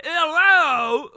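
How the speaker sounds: high, loud British squawk; hammed-up British shout; mock British accent. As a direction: yell it in a silly British voice.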